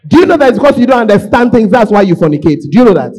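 loud, rising preacher's shout; preacher shouting at the congregation; full-force preaching. Real, not acted. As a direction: hammer the point home.

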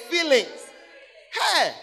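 usual speaking voice

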